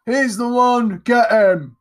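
high, loud shouted alarm; yell. A narrator's reading. Someone outside spots fleeing Jack, yelling. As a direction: shout it out to set pursuers on him. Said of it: "and someone yell".

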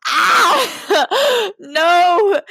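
loud, high wail breaking into laughter; cringing outburst. Real, not acted. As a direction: cry out in laughing horror. Cringing at her own writing.

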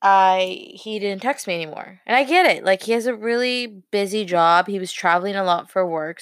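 usual speaking voice

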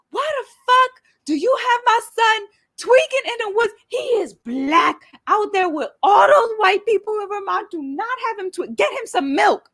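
shrieking mother on the phone; high yelling mom. Retold or imitated. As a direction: scold high and loud like an angry mom. Quoting his mom on phone.

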